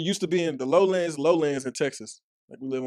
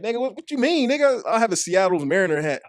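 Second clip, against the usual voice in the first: high, loud incredulous comeback; mock outrage, higher and louder; retold indignant protest; 8 semitones higher, 7 dB louder than usual. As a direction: protest loudly, pitch climbing. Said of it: "I'm like".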